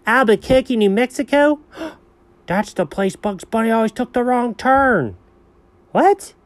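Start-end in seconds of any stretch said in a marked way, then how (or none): 5.9-6.3 sharp high "what"; surprised. Real, not acted. A high surprised "what" to the dog's claim. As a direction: jolt upward in disbelief.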